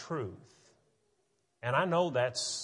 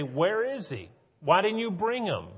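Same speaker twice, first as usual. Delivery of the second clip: demanding Pharisees; 6 semitones higher, 4 dB louder than usual. Retold or imitated.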